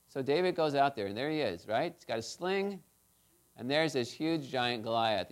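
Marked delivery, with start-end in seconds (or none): none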